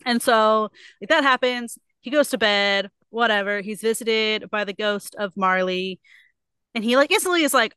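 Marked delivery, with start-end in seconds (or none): none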